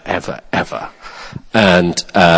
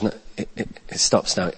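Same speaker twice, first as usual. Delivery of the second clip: quiet firm insistence; 2 semitones lower, 14 dB quieter than usual. Retold or imitated. Calm firm stand-off with angry man.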